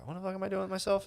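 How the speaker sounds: high and quiet, lightly whiny self-talk; self-questioning. Retold or imitated. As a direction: quote yourself in a small high voice. Mocking his own inner voice.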